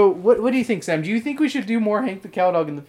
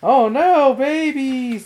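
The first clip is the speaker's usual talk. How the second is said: mock dismay; 8 semitones higher, 5 dB louder, much slower than usual. Imitating the dog's dismay.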